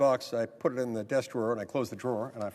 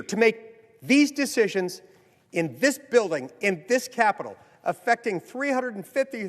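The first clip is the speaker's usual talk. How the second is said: raised, emphatic Senate floor oratory; formal speech; 7 semitones higher, 3 dB louder than usual. Acted. Senator urging colleagues on election security, emphatic oration. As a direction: drive the point home to the chamber.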